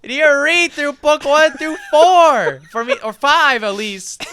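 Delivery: loud, high-pitched protest; exasperated shouting. Real, not acted. Friend protesting book one is little, exasperated shouting. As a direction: shout in disbelief at his friend.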